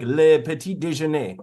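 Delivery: mock French speaker; random French. Shown as a demonstration. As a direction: toss it out with a showy French accent. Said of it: "saying random French words like".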